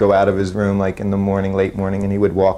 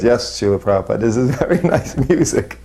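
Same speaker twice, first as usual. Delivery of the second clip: higher retold agreement breaking into a laugh; eager agreement; 3 semitones higher than usual. Disciple afraid of saying wrong thing, laughing eager agreement. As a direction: imitate your own too-quick yes, laughing. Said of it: "then of course I was".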